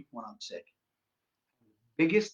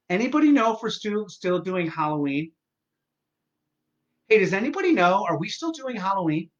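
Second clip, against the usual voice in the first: louder, higher mock call to everyone; mock loud post; 4 semitones higher, 5 dB louder than usual. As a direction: call out to the whole neighbourhood, hammed up. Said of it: "here was my last week".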